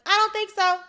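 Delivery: high, dismissive little retort; defiant retort. Retold or imitated. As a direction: push back like an indignant kid. Acting out childhood retort to teacher.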